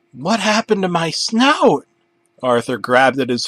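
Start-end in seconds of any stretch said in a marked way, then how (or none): 0.1-1.8 much higher alarmed question; panicked. Acted. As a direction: panic over the lost snout. Arthur panics at his face.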